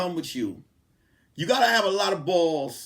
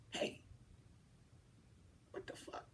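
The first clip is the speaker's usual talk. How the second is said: scared whisper under the breath; 24 dB quieter than usual. Retold or imitated. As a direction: hiss it so nobody downstairs hears. Sneaking, scared of the ex.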